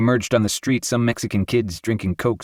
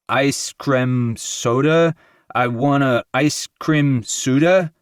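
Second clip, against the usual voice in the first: slow, lightly voiced New York accent; drawn-out New York accent, innocent asking; a hint of New York accent, slow and childlike; funny New York accent; 5 semitones higher, 3 dB louder, much slower than usual. A narrator's reading. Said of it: "in his funny New York accent".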